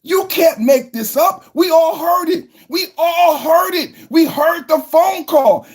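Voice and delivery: outraged shout. Real, not acted. Telling viewers they heard the call, outraged shout. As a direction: shout it like everyone knows. Ranting about Biden phone call.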